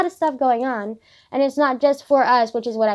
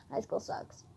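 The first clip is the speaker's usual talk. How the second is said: very soft, nearly voiceless; quiet grumble; 15 dB quieter, slower than usual. Real, not acted. Student sighing about homework to herself, quiet grumble. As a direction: grumble it under your breath.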